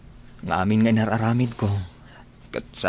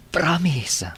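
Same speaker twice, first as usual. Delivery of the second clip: whisper; 6 semitones higher than usual. Acted. Marvin pleads softly with Rachel.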